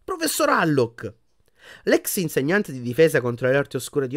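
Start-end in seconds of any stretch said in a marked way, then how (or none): 0.0-1.1 high, slow amazed exclamation; breathless astonishment. A narrator's reading. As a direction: recognise someone with amazement. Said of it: "esclamò Hermione quasi senza fiato".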